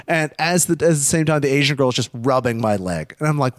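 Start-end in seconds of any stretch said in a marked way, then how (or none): none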